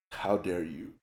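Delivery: low, deliberate affront, second time drawn out; mock indignation. Retold reply to the third guy who struck a nerve, indignant. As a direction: act gravely offended, stretching the repeat.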